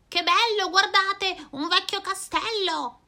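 più acuta e più forte, meravigliata; esclamazione entusiasta. Acted. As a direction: esclama stupita davanti al castello. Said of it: "esclama Rossella". (Rossella esclama entusiasta, voce alta)

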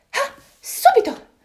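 a jumpy little monkey. Acted. Monkey eagerly answers the animals, in a high squeaky voice.

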